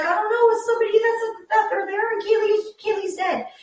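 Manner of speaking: high, loud panicked answer; frantic, stumbling high-pitched report; retold distress. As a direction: relive the daughter's panic, voice pushed way up. Retells daughter announcing the death.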